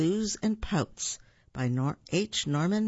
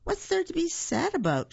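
much higher, puzzled squirrel; 13 semitones higher than usual. Acted. Shirl Squirrel asks Buford, high and puzzled. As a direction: ask brightly like a chirpy squirrel.

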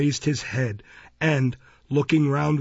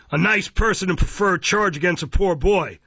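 loud, high sarcastic reproach; Mister Fang, mock-humane indignation; 6 semitones higher, 5 dB louder than usual. A narrator's reading. Magistrate affecting pity for the boy, mock-humane. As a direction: scold with pompous false sympathy. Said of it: "with a comical effort to look humane".

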